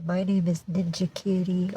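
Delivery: lightly voiced cat, small and quiet; voicing the cat. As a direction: introduce yourself softly as the kitty.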